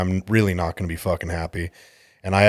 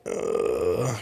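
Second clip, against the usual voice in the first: low drawn-out mock groan, much softer; a put-on sick groan; 9 semitones lower, 8 dB quieter than usual.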